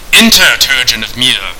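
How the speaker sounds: loud, stately summons; commanding host. Acted. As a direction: call the visitor in with authority. Unseen host summons Turgeon by name.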